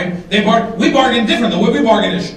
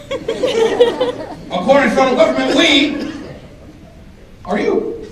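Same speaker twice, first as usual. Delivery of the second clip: mock official voice; 7 semitones higher, slower than usual. Mock government voice, laughing.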